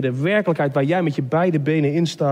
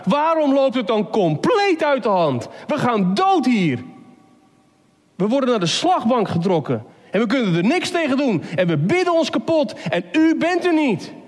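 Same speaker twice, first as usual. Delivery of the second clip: high-pitched desperate cry to God; anguished plea; 7 semitones higher than usual. Acted. Believer in despair questioning God, anguished. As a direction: plead with God, voice pitched right up.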